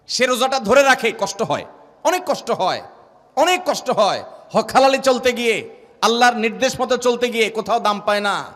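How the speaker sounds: loud, high, rising sermon litany; fiery preaching. Acted. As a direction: build the list of sufferings to a peak.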